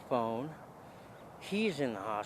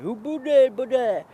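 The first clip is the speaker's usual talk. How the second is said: high, very loud babble; slurred gibberish; 11 semitones higher, 11 dB louder than usual. Retold or imitated. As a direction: imitate stroke-garbled words, loud and pitched up. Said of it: "he's slurring his words".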